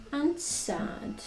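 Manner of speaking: usual speaking voice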